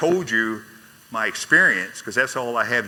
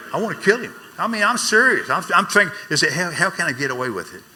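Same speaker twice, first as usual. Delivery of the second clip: much higher and louder, seething at his father; angry outburst; 8 semitones higher, 6 dB louder than usual. Retold or imitated. Recalling wanting to kill his father, loud and furious. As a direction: let the old rage burst out.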